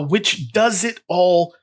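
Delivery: ordinary delivery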